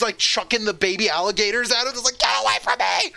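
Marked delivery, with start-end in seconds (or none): 2.2-3.1 hammed-up frantic cry; mock panicked yell. Woman chucking baby alligators at them, mock panicked yell. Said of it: "maybe make it a little funny".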